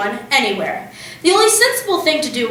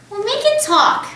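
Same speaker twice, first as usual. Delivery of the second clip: eager; 11 semitones higher than usual. A narrator's reading. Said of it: "Matilda said".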